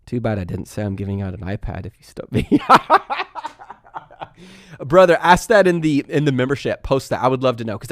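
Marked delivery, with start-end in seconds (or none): 2.5-3.7 loud, high laugh at himself; real amused laugh; burst of laughter. Real, not acted. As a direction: laugh it off after being stumped. Stumped by a viewer question.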